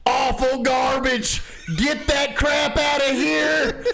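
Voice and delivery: hammed-up outraged commenter, high and slow; mock angry commenter. Mocking outraged Fox News commenters in a high, slow whine.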